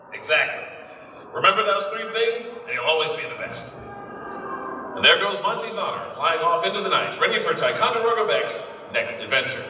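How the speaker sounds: put-on brisk old newsreel narration; peppy vintage announcer read. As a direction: sell it like a 1930s newsreel.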